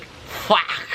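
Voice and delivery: "Fuck" as a raspy, coughed-out "fahk"; imitated narrator's accent. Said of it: "The way he pronounced the word".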